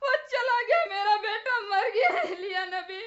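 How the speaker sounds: tearful, grief-stricken wailing; crying. Acted. Mother tells the prophet her son has died, crying. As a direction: sob through the words in mourning. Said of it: "मत रो बेटी".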